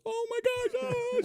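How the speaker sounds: high, squealing gasp of delight; a delighted high mock squeal; excited fiancee. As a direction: squeal it like she can't believe it.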